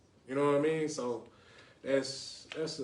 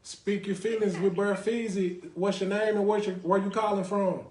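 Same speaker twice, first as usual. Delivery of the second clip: radio host intro; 5 semitones higher, 3 dB louder than usual. Acted.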